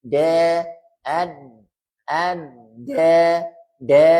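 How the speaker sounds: "de" struck hard and loud off the tooth ridge; strong de. Shown as a demonstration. Teacher drills the student on a strong, loud de. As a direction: hit each "de" with force, loud. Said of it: "Jadi kuat".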